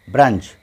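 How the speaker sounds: ब्रंच said "brunch", short uh; short central vowel in ब्रंच. Shown as a demonstration. Teacher contrasting the u sound for learners, short vowel. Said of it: "यहाँ यू की आवाज़".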